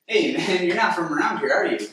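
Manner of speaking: high, bouncy everyman hello; random guy on the street. Acted. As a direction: play an over-friendly passerby. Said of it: "like, a random guy on the street".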